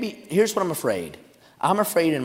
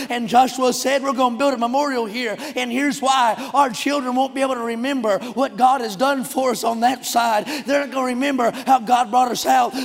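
high, loud revival preaching; shouted, exultant pulpit cadence; full-force preaching; 7 semitones higher, 8 dB louder than usual. Real, not acted. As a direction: preach it like a rousing revival, driving every phrase.

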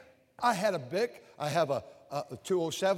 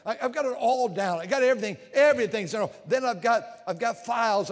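emphatic; 4 semitones higher, 6 dB louder than usual. Real, not acted. Preacher exclaims his organization.

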